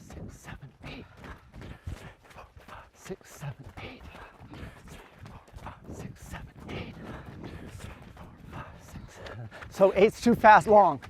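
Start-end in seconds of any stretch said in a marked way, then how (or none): 0.1-9.2 hushed, unvoiced count in rhythm; whispered running count; breathy counting. Real, not acted. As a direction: count under your breath with each stride. Coach counting along with runner's breaths.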